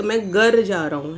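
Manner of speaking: ordinary talk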